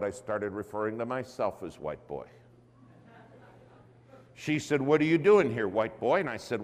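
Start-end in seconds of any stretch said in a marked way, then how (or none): 4.8-6.2 a hint of a sassy church lady; louder, challenging lady. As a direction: question thrown out louder and blunter. Lady's blunt question retold again.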